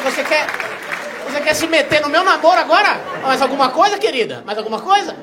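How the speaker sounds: loud, high, mock-offended retort; mock indignant. To the waiter meddling in his relationship, loud mock outrage. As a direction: snap back at the waiter in fake outrage.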